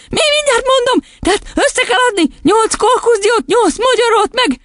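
put-on very high, confused boy; flustered. A narrator's reading. Boy adding up nuts on his fingers, flustered. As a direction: count aloud in a fluster. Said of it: "teljesen összezavarodott".

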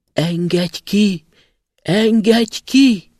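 slow, high, loud pleading cries from underground; a trapped spirit calling out to be freed, drawn out and loud; muffled trapped cry. A narrator's reading. Trapped spirit begging the passing student: slow, high, muffled cry. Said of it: "egy tompa hang azt kiáltozta".

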